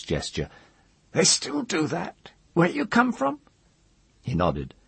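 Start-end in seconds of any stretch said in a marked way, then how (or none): 1.1-3.4 put-on very high, questioning old crone; curious old woman. A narrator's reading.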